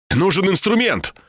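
high urgent outcry; cried out. A narrator's reading. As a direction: call out for help, pitch up. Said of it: "выкрикнул я". (взволнованный выкрик повыше)

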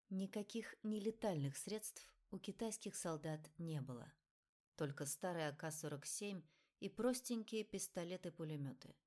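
ordinary delivery, nothing marked

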